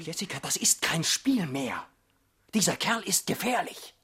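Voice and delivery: unvoiced urgent whisper. Husband warning his wife, urgent whisper. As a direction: warn her under your breath.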